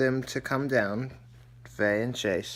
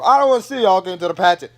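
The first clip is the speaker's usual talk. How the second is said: fast, loud, higher mock rant; angry outburst; 6 semitones higher, 13 dB louder, much faster than usual. Savannah storming out on her parents, fast angry rant. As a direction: fire it off as she storms out.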